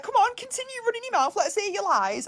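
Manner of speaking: mock-brave high tease; high taunting. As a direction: goad them in a high sing-song. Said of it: "I was like".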